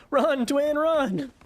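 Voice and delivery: high voiced boss quote; mock boss line. Gamer quoting the boss, mock high yell. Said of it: "That's all he had to say".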